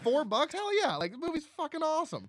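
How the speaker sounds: high-pitched enthusiastic outburst; excited reaction. Retold or imitated. Podcast host recalls happily paying to rent the movie, excited. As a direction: blurt it out, pitch soaring.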